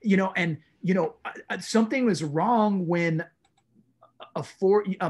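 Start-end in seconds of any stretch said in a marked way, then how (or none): none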